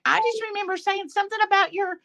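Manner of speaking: mock her southern twang. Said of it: "Fancy's like".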